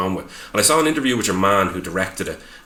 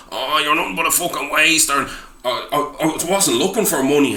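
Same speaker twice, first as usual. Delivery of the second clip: mocked film character; 8 semitones higher than usual. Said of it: "and your man's like".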